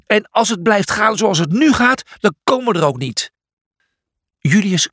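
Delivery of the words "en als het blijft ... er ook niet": high, irritated retort; raised-pitch exasperation; snapped. A narrator's reading. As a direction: snap back crossly. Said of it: "snauwde Julius".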